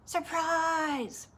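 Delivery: high-pitched, drawn-out cheer; mock shout leading children to join in; party shout. A narrator's reading. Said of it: "Can I hear you shout that?".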